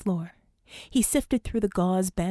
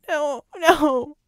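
higher, louder sobbing protest; 6 semitones higher, 8 dB louder than usual. A narrator's reading. Vicky sobbing no at Red as he revives her. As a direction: cry out no through tears. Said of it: "she sobbed".

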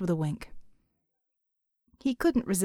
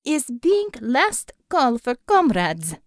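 put-on slow, deceptively sweet Russian accent; a sweet-sounding Russian last call, slow; Russian accent, mild and sweet; 5 dB louder, slower than usual. Savior announcing closing time to comrades, in mild, sweet Russian accent. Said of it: "her voice deceptively mild and sweet".

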